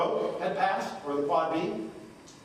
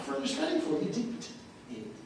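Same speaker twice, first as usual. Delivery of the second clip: normal talking voice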